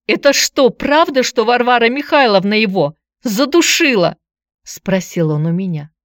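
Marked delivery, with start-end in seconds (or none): 0.1-4.2 high, loud urgent question; agitated. A narrator's reading. Boy asks visitor about the killing, agitated. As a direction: demand the truth, worked up. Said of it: "Глаза его возбуждённо блестели".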